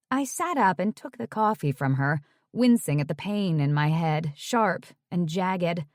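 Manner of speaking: ordinary talk, nothing marked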